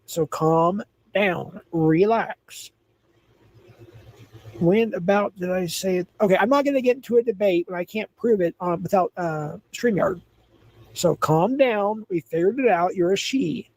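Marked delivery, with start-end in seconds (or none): 0.1-2.7 slow calm down. Real, not acted. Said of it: "calm down or I have to ban you". 10.9-12.1 slow calm down. Real, not acted. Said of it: "calm down or I have to ban you".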